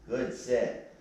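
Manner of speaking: normal talking voice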